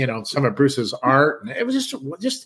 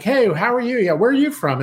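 friendly; 8 semitones higher than usual. Retold or imitated. Friendly greeting to newcomer.

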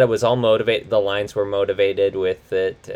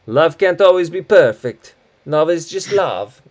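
high, loud imitation of Massimo's accent; movie character's accent; 7 semitones higher, 7 dB louder than usual. Host quoting Massimo's favorite line, in exaggerated accent. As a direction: put on a hammy movie accent. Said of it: "like this one where it's like".

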